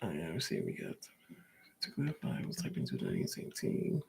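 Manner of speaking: half-whispered, reading off his own typing; muttering to himself. Real, not acted.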